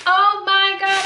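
high, loud gushing, fading down; overwhelmed by cuteness. Real, not acted. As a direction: squeal with delight, then settle. Unboxing chalkboard, overwhelmed.

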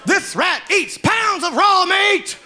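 loud, hammed-up carnival barker spiel; sideshow barker pitch. Carny barker luring people into his tent, loud spiel. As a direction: hype the rat like a carny. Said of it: "And he kept going".

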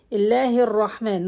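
ordinary talk

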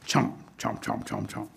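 low, hushed chomping sound effect; munch out each chomp softly; imitated chomping. Imitates alligator chewing.

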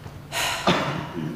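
long unvoiced tired sigh; weary sigh. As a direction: let out a heavy breath. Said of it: "The ant heaved a sigh".

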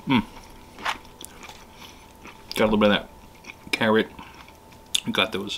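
talking with food in mouth; slightly muffled chewy speech; chewing while talking. Real, not acted. Seen 2: Forkful of cabbage at -1.0, then mouth working, head down at plate.

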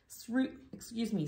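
ordinary delivery, nothing marked

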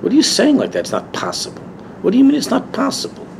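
high-pitched, disbelieving retort; incredulous rebuke. Retold or imitated. Rebbe answers a chassid who says it's impossible, incredulous. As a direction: imitate a Rebbe challenging a doubter in disbelief.

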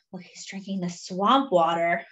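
usual speaking voice

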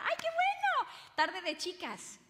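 very high, slow, loud gushing mom; high, slow mock mother's delight; delighted permissive mom. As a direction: gush like an indulgent mother. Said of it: "Yo podía decir".

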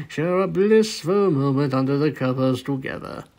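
ordinary delivery

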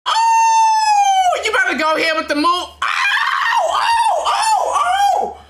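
very high, loud excited shouts; excited shouting. Real, not acted. Fan shouting as the hero returns. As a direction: lose it with joy.

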